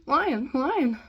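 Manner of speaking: high, loud panicked shout; screaming. A narrator's reading. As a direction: shout it in alarm.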